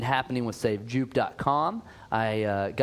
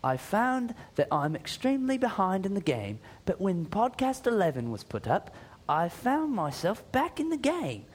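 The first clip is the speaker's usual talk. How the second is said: put-on a higher, amateur British accent that drifts toward Australian; attempted British accent; 6 semitones higher than usual. Reading a UK listener's email to the cohost in a wobbly British accent. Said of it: "I'm gonna read it in my, my best".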